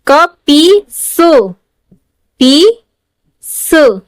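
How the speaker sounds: very slow, each syllable apart; syllables sounded out. Shown as a demonstration. Teacher to pupils, sounding out syllables slowly. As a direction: break the word into its parts, slowly. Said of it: "teacher sounds out syllables".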